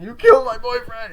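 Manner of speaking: high, loud acted outburst; screamed accusation. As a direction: imitate a distraught accusing shout.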